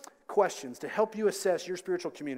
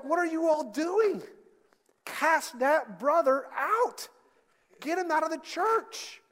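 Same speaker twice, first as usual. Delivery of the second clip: loud, high-pitched indignation; outraged rebuke; 10 semitones higher, 5 dB louder, slower than usual. Acted. Paul scolding the church at Corinth, outraged rebuke. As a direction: hammer out the orders, aghast.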